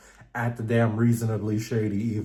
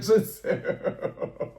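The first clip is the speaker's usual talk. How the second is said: slow whispered Giselle through a laugh; exasperated; 9 semitones higher, much slower than usual. Real, not acted.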